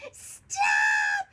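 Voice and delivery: put-on very loud, harsh child's shout; little girl's all-out holler; full-force yell. A narrator's reading. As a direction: bellow it as loudly as you can. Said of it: "yelled as loudly as she could".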